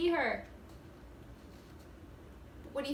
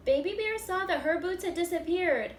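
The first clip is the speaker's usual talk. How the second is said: lively, louder read-aloud with bear voices; animated storybook reading; 6 dB louder than usual. A narrator's reading. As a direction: act it out for the first graders. Reading picture book aloud.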